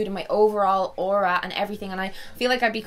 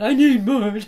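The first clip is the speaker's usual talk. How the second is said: mock craving whine; 3 semitones higher, 8 dB louder than usual. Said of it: "I'm like".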